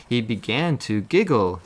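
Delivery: usual speaking voice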